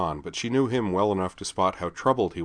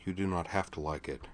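much softer, gentle and calm; gentler voice; 9 dB quieter than usual. Acted. Laura to Griffin, hand on his shoulder, gentle. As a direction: reassure him gently. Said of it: "said in a gentler voice".

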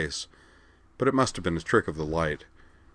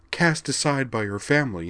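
mocking imitation; 7 semitones higher than usual. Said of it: "mocking imitation".

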